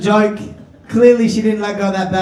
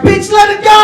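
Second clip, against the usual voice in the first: high, very loud outburst; exasperated yell; 13 semitones higher, 9 dB louder than usual. Acted. Comic to the woman clutching her purse, exasperated yell. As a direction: yell it at full force, pitched right up.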